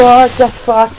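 sudden shocked yell, pitched high; startled shout. Real, not acted. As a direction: blurt it out in a real fright. Jump scare in horror game.

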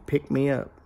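soft, lightly voiced repeated plea; a hint of a small hidden creature; mysterious little voice. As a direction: quietly imitate an unseen creature calling. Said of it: "Noise kept saying".

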